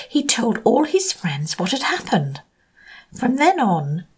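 ordinary delivery

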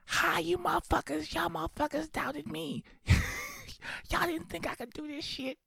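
high put-on voice, gloating at friends; hammed-up victory gloat; mock triumphant taunt. Bursting into the basement bar to gloat at friends, high mock voice.

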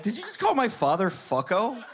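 high, laughing through the question; can't believe it, cracking up; laughing disbelief. Real, not acted. Everyone laughing at mom's insult.

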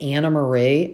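"Anna Marie" with an extra syllable after Ann; mispronounced name. Shown as a demonstration. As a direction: say "Anna Marie" as three parts, An-nuh Marie. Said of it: "they're calling her".

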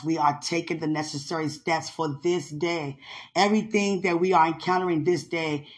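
low, soft, earnest prayer voice. Real, not acted. Praying to the Father for the day ahead, soft and earnest.

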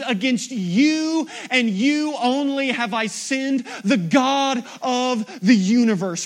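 loud, high, driving sermon; preaching at full force. Acted. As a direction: drive the point home to the congregation.

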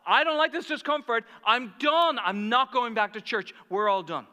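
high, loud acted outburst; humiliated and fed up. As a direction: imitate someone hurt and quitting in a huff. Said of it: "she felt humiliated, and she says".

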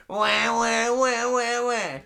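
put-on childish wah wah whine; mock whining. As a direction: whine like a sulking kid. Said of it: "Just like when China doesn't wanna make a trade".

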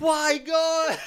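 a very high theatrical cry to the heavens; mock despairing wail. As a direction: wail it like comic grief over a ruined laptop. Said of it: "I just lost it".

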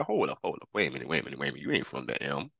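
mock small-town cops sizing up a stranger; suspicious locals. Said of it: "And they're like".

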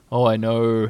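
humble, resigned admission; humbly. A narrator's reading. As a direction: own up meekly as the bear. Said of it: "said Pooh humbly".

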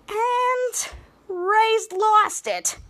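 high, very drawn-out call; announcing a meltdown. Retold or imitated. Mika announces that Ray lost it, high singsong. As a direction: stretch each word out in a high voice.

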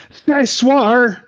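stilted, high bad American French accent, word by word; mock a clumsy American French accent. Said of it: "sounded like an American doing a really bad French".

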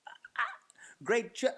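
choking on forced praise; stammer, then break off mid-word; can't say it. Acted. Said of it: "kind of person who just can't say it".

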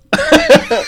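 high squealing laugh. Real, not acted. Laughs at "nice.

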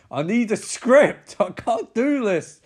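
high, loud, slowed mock wail; exasperated outburst. Overwhelmed actor in his office, exasperated yell. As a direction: cry out in overwrought despair.

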